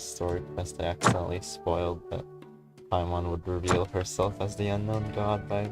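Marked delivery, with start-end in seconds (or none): none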